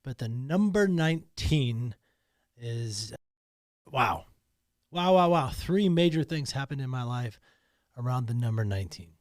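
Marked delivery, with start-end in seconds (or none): none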